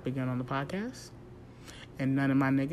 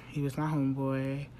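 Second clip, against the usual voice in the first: ordinary talk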